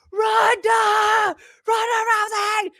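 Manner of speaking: high, loud fan screams; screaming fans. Retold or imitated. Fans screaming her name on the red carpet. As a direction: call her name like a crowd. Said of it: "fucking screaming".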